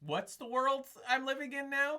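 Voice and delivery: mock baffled what-world-is-this; retold disbelief. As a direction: replay your jaw-drop at the interview. And I went.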